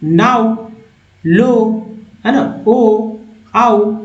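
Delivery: नाउ and लो said clearly, each set apart; words modelled for learners. Shown as a demonstration. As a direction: spell out नाउ and लो one by one, clearly. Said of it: "यहाँ नाउ पढ़ेंगे".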